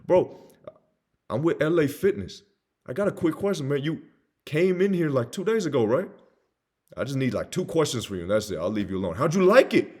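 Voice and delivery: energetic, familiar phone patter; hyped buddy salesperson. Acted. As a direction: pitch it like talking to a bro. Acted buddy-style sales call.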